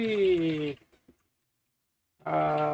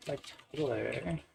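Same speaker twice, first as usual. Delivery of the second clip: put-on Valley girl; 8 dB quieter than usual. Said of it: "I sound too much like a Valley girl".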